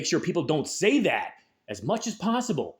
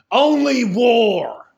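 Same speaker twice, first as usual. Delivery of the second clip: loud, slow and higher, drawn out; climactic shout; 7 semitones higher, 8 dB louder, much slower than usual. Real, not acted. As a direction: yell it slowly at full force. Climax of the rant.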